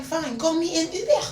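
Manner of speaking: high mock French accent, rising; put-on French accent. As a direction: retort in a comic French accent. Her French persona answers him.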